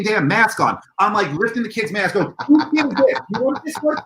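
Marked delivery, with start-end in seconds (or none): 2.5-4.0 high, hurried mock shouting to parents; hectic calling out. Organizer to parents while unmasking kids, hectic calling out. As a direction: act out frantically handing back kids, pitched way up.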